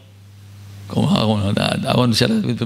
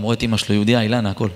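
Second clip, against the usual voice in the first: ordinary talk, nothing marked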